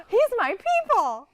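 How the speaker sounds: high, loud, slow delighted cry; excited recognition. Retold or imitated. Telling husband about the cartoon narrator, delighted. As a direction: gasp and claim him as your own kind. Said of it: "And I was like, gasps".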